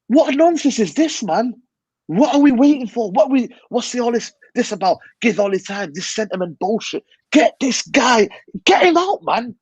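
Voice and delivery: fed up, voice rising to a near shout; exasperated rant. Real, not acted. Furious rant at the manager.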